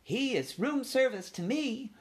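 high, slow, happy mother voice; light, carefree lady mouse. A narrator's reading.

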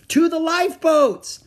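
high, loud warning yell; urgent yell. A narrator's reading. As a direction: yell an order to everyone in the room. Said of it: "Annie yelled at the card players".

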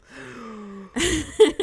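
a loud, high, mock-scandalized gasp; shocked gasp. Real, not acted. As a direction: gasp as if the joke went too far. Reacting to a naughty joke.

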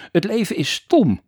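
loud high shout. Acted. Boy shouts back at his father, loud and high. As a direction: yell it back in defiance. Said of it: "schreeuwde Frederik".

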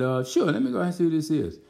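high-pitched, quick retold reply; put-on agreeable reply. Pastor answering the usher at the table, put-on high reply. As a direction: toss it off high and fast.

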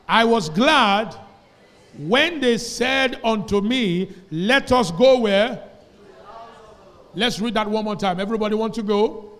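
loud, slow, ringing scripture reading; big pulpit voice, drawn out and loud; preaching at full force. Acted. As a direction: proclaim every word to the whole church, slowly.

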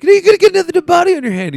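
high, loud and fast mock boss voice; mocking gruff boss. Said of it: "Dennis Franz says something where he's like".

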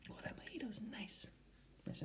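soft unvoiced whisper. Real, not acted. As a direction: savor it in a whisper. Savoring drink, whispered.

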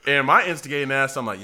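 ordinary talk

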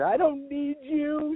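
high, slow outburst; mock hysterical woman; freaking out. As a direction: shriek it slowly in panic. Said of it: "she's freaking".

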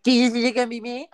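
high, loud, quick singsong mockery; mocking mimicry. Jesus mimicking Judas's question back at him, mocking. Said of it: "Imagine if he mocks him".

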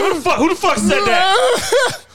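very high, drawn-out startled question; mock bewilderment. Boys hearing a phantom voice, comically startled. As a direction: play up the confusion, half laughing.

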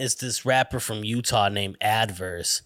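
ordinary talk, nothing marked

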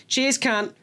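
a pissed Australian's cheerful thanks, imitated; drunk Australian accent. Drunk Darwin workmate thanking her friend, mock Australian accent. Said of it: "she was pissed".

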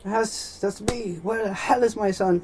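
high-pitched, blustering rich-man voice; hammed-up, imperious father; bossy rich father. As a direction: order the nurse about in a high, haughty voice. Father demands his son.

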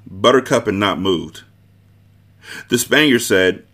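plain reading voice

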